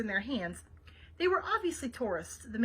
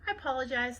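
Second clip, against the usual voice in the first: usual speaking voice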